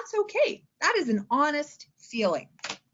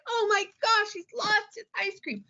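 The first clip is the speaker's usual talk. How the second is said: a touch higher, hammed-up shock; dramatic dismay; 8 semitones higher than usual. Shown as a demonstration. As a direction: gasp out the loss. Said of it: "Big dramatic".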